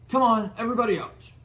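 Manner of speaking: high, loud, fast command; loud fast order to the family; loud uncle order. Acted. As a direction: bark everyone out of the car.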